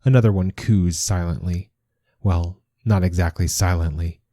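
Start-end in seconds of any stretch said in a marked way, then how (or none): none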